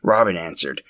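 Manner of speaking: usual speaking voice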